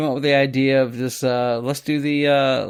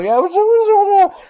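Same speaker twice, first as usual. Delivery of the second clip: high, loud muffled parody; high mock of unintelligible talk in a noisy truck; mock garbled voice; 7 dB louder than usual. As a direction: mimic a voice lost on a bad mic. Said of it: "And I was like".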